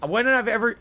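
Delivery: ordinary talk, nothing marked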